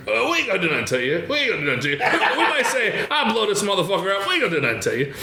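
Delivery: a hint of Bernie Mac's stage bluster; Bernie Mac impression. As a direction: rattle it off laughing like a stand-up. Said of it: "Bernie Mac goes".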